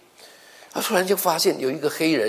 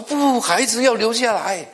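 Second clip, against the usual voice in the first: insistent outcry; 10 semitones higher, 5 dB louder, slower than usual. Retold or imitated. Husband insists on keeping baby.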